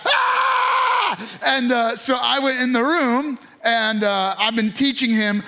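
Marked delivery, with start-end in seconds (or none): 0.0-1.2 long, high, sustained wail; drawn-out high mock scream; imitated baby scream. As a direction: mimic a baby wailing for dad. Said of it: "screaming at the top of their lungs".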